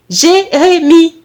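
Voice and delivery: slow, loud, syllable-by-syllable call; high, drawn-out mother's summons; insistent call. Acted. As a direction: stretch out the name to get his attention. Mother calls him again, insisting.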